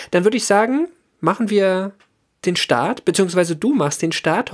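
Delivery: ordinary talk, nothing marked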